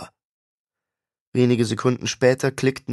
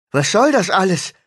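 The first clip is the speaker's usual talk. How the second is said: higher, louder alarmed cry; alarmed call; 8 semitones higher, 7 dB louder than usual. A narrator's reading. Lucius cries out as the patient grips his wife, alarmed. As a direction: shout in panic for his wife. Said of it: "rief Lucius".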